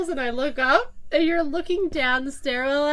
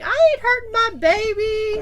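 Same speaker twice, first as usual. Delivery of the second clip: put-on drunk slurring voice; 9 semitones higher, 6 dB louder than usual.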